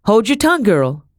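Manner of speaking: high, loud rebuke; commanding. A narrator's reading. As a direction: order a servant girl into silence. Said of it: "Madam commanded".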